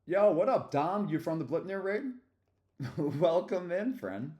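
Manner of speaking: loud, high-pitched shout-out to an arriving friend; real excited welcome; excited greeting. Real, not acted. Greeting a raid on stream.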